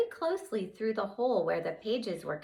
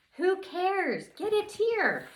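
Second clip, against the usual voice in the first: high, pushy urging; pushy boy urging his sister; impatient brother; 13 semitones higher, 4 dB louder, slower than usual. Acted. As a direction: hurry her up, high and insistent.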